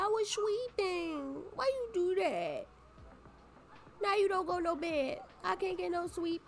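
put-on talking for the baby, whiny and high. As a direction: coo it like a fussy baby talking to mommy. Voicing baby talk to mom.

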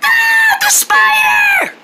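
high slow frightened shout; scared shout. Acted. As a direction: cry out at the spider. Spider returns.

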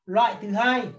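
ordinary talk

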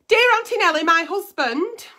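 letter reading. Acted. Reads a reader's letter aloud.

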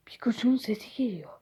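gasp, then unvoiced whisper. Scared kid whispering he hears Pikachu.

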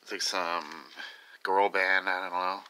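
ordinary delivery

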